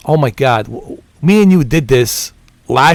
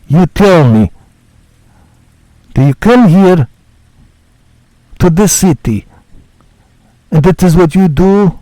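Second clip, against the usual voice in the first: Nico Bellic voice; 3 semitones higher, 4 dB louder than usual. Pretend. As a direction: play a blunt immigrant hero who is fed up with the city. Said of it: "Can I do a Nico Bellic impression?".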